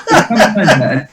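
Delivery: laughing through the words. Real, not acted. Comedian laughing while recalling the company manager's parrot. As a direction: chuckle as you set up the story.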